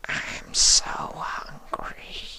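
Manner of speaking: puzzled creature sensing love; slow wondering changeling. A narrator's reading. Changeling weak.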